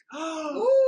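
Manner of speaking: a sudden high gasp of delight; sharp high gasp. Real, not acted. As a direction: gasp as if the glasses stun you. Reacting to the sunglasses try-on.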